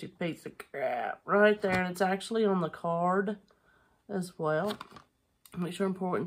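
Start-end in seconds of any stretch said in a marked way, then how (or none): 0.0-1.2 low, soft muttered insult; annoyed mutter. Real, not acted. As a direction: mutter it under your breath at the camera. Camera finally focuses, annoyed mutter.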